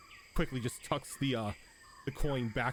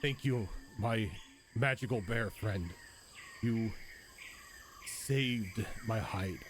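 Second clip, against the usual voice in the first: slow ogre voice; slower than usual. Acted. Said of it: "He's like".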